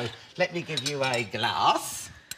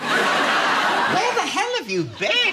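high, loud, slow incredulous laugh; laughing disbelief; 6 dB louder, much slower than usual. Real, not acted. Host mocking the guest's jolly London remark, laughing disbelief. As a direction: gasp it out in amused disbelief. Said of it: "People are so jolly?".

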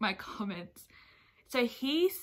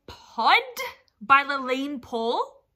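indignant, can't-believe-it questioning; incredulous outrage; 4 semitones higher, 12 dB louder than usual. Real, not acted. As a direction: fire it out loudly, pitch leaping at the end. Incredulous at the listed book.

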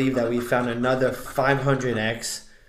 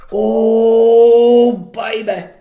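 excited oh; 8 semitones higher, 11 dB louder, much slower than usual. Real, not acted. Reacting excitedly on stream.

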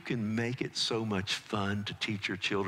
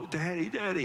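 put-on child calling daddy; 8 semitones higher than usual. Son calling after a fall.

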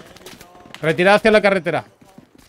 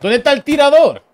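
raised, urgent yell over gunfire; shouting orders over battle; 5 semitones higher than usual. Real, not acted. Calling for medic under fire.

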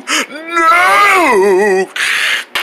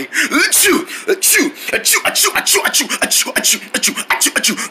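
pretend sneezing fit; 3 semitones higher than usual. Wrinkle Head can't stop, pretend sneezing fit. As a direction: fire off little achoos one after another.

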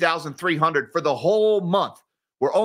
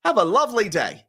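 put-on second try at a British accent, higher pitched; hammed-up British accent, lifted in pitch; Tim Curry; 5 semitones higher than usual. Host retrying a failed actor impression, higher British accent. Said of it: "as the great Tim Curry would say".

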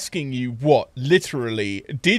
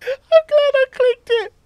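squealing laughter; 3 dB louder than usual. Real, not acted.

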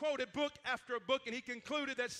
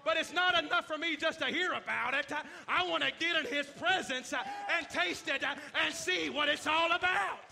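fervent, louder preaching; 3 semitones higher, 6 dB louder than usual. Acted. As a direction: preach it with full conviction. Preaching opening, loud.

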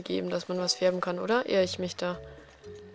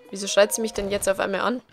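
ordinary delivery

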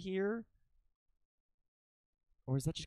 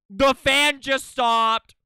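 louder, slow, higher call-out; loud announcing; 8 semitones higher, 13 dB louder, much slower than usual. Real, not acted. Spots anomaly, announces loudly.

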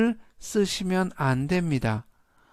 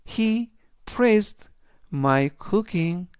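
slow, a little higher, word by word; slow model read; 3 semitones higher, much slower than usual. Shown as a demonstration. Teacher reads the example to students, slow and clear. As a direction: read the example slowly for learners. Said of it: "전체적인 의미는".

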